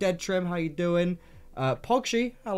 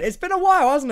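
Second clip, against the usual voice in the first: excited; 10 semitones higher, 9 dB louder than usual. Real, not acted. Greeting co-host Fraser.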